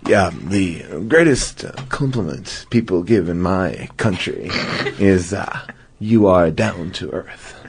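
low, slow lightly voiced Depp; a hint of a drawling Johnny Depp; Johnny Depp impression. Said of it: "Johnny Depp's lines".

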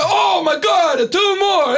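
put-on high, shouted Mario-style Italian accent; mock Mario Italian. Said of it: "everybody sounds like it's Mario Brothers".

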